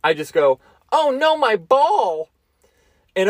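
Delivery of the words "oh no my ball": high, slow "Oh no, my ball!"; heightened voice. Retold or imitated. To coworkers at a drinking game, high slow mock dismay. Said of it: "did the same thing that with the penny".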